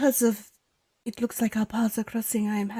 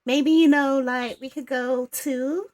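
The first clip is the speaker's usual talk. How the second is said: put-on Valley girl accent; 3 semitones higher, 3 dB louder than usual. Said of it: "sounding like a Valley girl".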